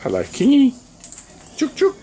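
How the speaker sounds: high sing-song call to a bird; calling chickens. Real, not acted.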